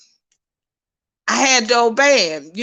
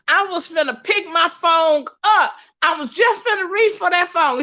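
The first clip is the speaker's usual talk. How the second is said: higher-pitched, eager recounting; animated retelling, pitched up; excited retelling; 7 semitones higher than usual. Retold or imitated. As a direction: relive being too excited to wait. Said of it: "so excited".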